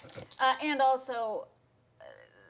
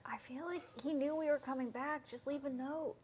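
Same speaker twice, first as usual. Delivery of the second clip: hushed in-character whisper; 10 dB quieter than usual. Acted. Player whispering a suspicion to the group, very soft. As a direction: whisper it so only the party hears. Whispering to party near house.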